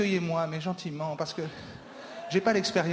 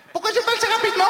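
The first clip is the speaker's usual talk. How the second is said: high loud shout of the question; 8 dB louder, much faster than usual. Acted. Performer on stage yelling at the crowd, high and loud.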